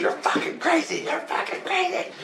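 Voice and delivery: squeaky falsetto wife impression, thinning out; mock a scandalized wife in a very high, light voice; imitated wife. Wife to husband just woken, high falsetto mock scolding.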